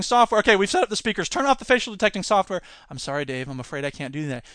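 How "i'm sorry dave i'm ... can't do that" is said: lower, much softer computer voice; calm computer. Retold or imitated. Quoting the refusing computer, low and soft.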